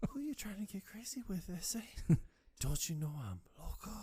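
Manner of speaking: high, breathy mock threat; high tough-guy voice. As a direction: play a crazy tough guy. Acted challenge voice.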